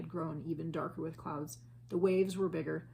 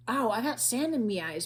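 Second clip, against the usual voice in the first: higher whining pirate complaint; whined; 5 semitones higher, 8 dB louder than usual. A narrator's reading. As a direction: moan about the sand. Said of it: "Pinky whined".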